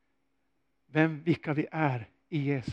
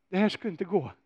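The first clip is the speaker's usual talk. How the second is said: ordinary delivery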